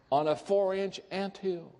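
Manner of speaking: usual speaking voice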